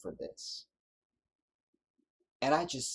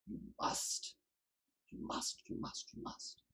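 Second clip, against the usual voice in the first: breathless whispered repetition; whispered plea; 11 dB quieter than usual. Real, not acted. Preacher begging his people, in a fading whisper. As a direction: let it fall to a whisper, pleading.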